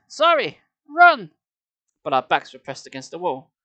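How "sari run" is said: loud, high urgent yell; screamed. A narrator's reading. Narrator urging Sari away from the mummy, screaming. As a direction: shout the warning at full pitch. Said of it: "I screamed".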